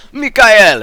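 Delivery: put-on high, loud cry in a heavy character accent; heavy Mikael accent. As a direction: call out the name with a hammed-up heavy accent. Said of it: "characters with the really heavy accents".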